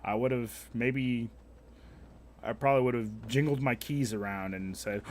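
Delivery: normal talking voice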